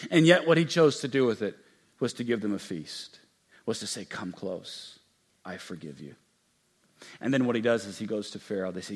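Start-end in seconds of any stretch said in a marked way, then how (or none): 4.1-6.1 much softer and lower; almost whisper it tenderly; soft tender release; hushed forgiveness. Acted. Joseph forgiving.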